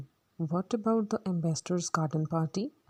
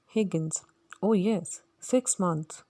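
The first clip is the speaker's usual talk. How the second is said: ordinary delivery, nothing marked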